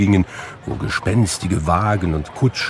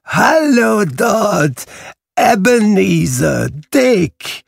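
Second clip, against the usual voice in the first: much higher, slow, hearty shout; Fezziwig's jolly call; 13 semitones higher, 4 dB louder, much slower than usual. A narrator's reading. Old Fezziwig calling his apprentices to close up, a hearty shout. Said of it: "rief mit einer behäbigen ... heiteren Stimme".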